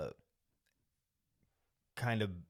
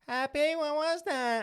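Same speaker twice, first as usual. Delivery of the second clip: very high, drawn-out mock yell; imitated mom yelling; 7 dB louder than usual. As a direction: holler like a mother from another room. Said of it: "you hear the mom yelling".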